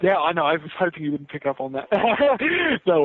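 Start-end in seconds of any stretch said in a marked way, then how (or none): 1.9-2.8 short embarrassed laugh; sheepish laugh. Real, not acted. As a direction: laugh it off, caught out. Caught out, laughs it off.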